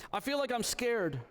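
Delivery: put-on a child confessing fear at bedtime; little daughter. Daughter voiced in bedtime story.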